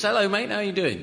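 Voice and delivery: a lightly voiced talking dog; chatty talking dog. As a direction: hail the bloke like a cocky mutt. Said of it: "the dog says".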